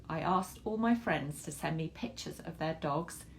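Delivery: plain reading voice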